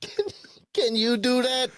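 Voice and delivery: very high, drawn-up mimicry; imitating Italian customer. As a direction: echo the goading question in a mock high voice. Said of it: "how many times has she heard".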